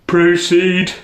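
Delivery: a lightly yawned "Proceed"; bored king waving him on; mild yawn. Acted. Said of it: "the king yawned".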